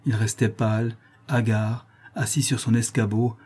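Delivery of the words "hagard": plain reading voice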